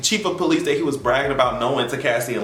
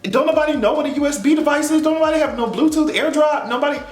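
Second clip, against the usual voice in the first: fast, raised, exasperated disbelief; 12 semitones higher than usual. Retold or imitated. Viewer mocking the CDs, high exasperated disbelief. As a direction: throw up your hands at the old tech. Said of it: "I was like".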